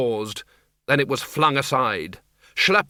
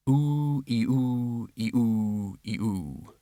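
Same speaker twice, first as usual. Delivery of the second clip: wavering oo-ee moan, sinking away; understated ghostly keening, dying out; banshee wail; 3 dB quieter, much slower than usual. A narrator's reading. Banshee's wail read from note.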